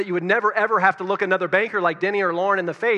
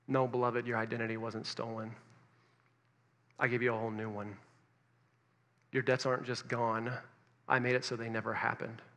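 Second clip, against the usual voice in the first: soft, low, gentle reading; tender note; 6 semitones lower, 11 dB quieter than usual. Retold or imitated. Reading God's note to the beloved debtor, soft and tender. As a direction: read it like a loving whisper of grace.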